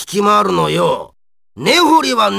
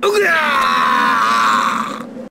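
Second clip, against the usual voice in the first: long drawn-out roaring scream; battle scream; much slower than usual. Acted. Fighter struck in battle, long raw scream. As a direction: scream and let it trail down.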